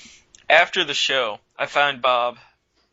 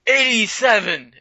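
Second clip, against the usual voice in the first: put-on higher, slow, cocky drunk answer; defiant drunk; 7 semitones higher, 5 dB louder than usual. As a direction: drawl out a smart-aleck reply. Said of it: "Bob goes".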